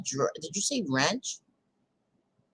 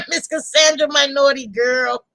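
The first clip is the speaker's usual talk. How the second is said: teasing; 6 semitones higher, 8 dB louder than usual. Real, not acted. Teasing a viewer by name.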